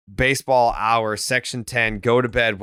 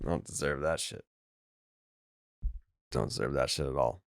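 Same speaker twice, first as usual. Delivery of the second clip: muttering; 9 semitones lower, 11 dB quieter than usual. Real, not acted. Muttered self-deprecating aside.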